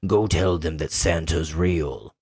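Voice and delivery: low, slow, menacing imitation of Santa; scary Santa voice. Imitating horror Santa grabbing her hand, scary low voice. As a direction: do a creepy horror Santa, drawn out. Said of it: "in his scary voice".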